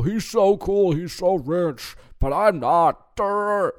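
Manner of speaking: hammed-up starstruck dummy; mock envious fan. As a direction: play a dim follower gawking at a rich guy. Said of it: "you know".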